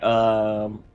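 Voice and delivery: normal talking voice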